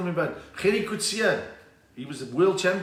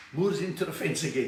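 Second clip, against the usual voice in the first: normal talking voice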